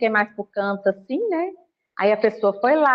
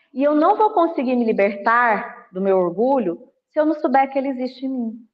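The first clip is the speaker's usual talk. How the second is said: normal talking voice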